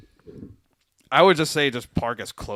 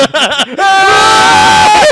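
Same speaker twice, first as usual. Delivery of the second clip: loud, high burst of real laughter; loud laughing fit; 15 dB louder than usual. Real, not acted. Friends cracking up over the Evil Dead joke, loud laugh.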